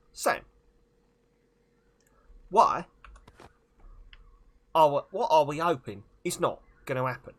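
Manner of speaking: hammed-up film-star impression in short, punched phrases; loud, halting celebrity impression; Michael Caine. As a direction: break every sentence into emphatic chunks with pauses. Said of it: "Do a Michael Caine impression".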